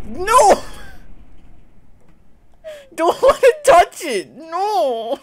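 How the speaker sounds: high panicked screams and cries; terrified screaming. Real, not acted. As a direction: shriek in real panic, no no. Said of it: "you've seen genuine fear from me now".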